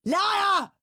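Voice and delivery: high loud accusing yell; shouting liar. Acted. Henry yelling at Peter. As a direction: fling the word at him. Brothers quarrelling.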